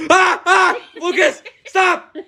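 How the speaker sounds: very high mock shriek, drawn out; imitating mom. Son mimicking mom's panic as geese flap, high shriek. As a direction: shriek like a frantic mother. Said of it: "And it's like".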